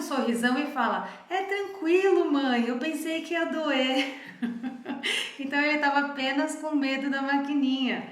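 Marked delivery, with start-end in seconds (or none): 1.3-4.2 put-on higher, louder and slow, like a calm little boy; a small boy soothing his mother, higher and slower; calm child voice. 4.4-8.0 laughing through the words; amused, still laughing; real laugh while speaking. Real, not acted. Amused after child's reply.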